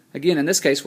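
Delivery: usual speaking voice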